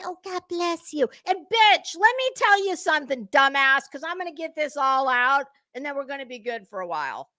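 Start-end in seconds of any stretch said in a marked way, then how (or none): 0.1-1.1 mock pious, sweetly put-on voice; sugary fake blessing. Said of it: "And say". 1.3-5.5 angry outburst. Real, not acted. Telling off Betty directly.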